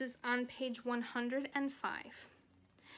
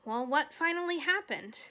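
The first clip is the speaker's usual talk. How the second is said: curious mother, louder and higher; 5 semitones higher, 7 dB louder than usual. Acted. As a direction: give in and demand the ending. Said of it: "whose curiosity began to get".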